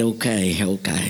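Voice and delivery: low, bashful country voice; old farmer. Acted. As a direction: give in like a shy old farmer. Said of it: "Billy was this old farmer".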